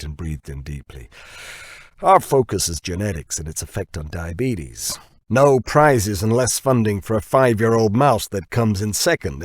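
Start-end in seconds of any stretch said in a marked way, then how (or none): 2.0-9.4 brash American boss, rising to a loud challenge; Dirk's voice. Acted. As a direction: push it like a boss who's sure he's right, louder at the end. Dirk pushes his point.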